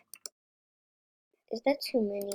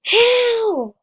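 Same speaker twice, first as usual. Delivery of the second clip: high, loud stunned yelp; astonished how; 12 semitones higher, 11 dB louder than usual. Real, not acted. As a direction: blurt it out in total disbelief. Astonished at other player.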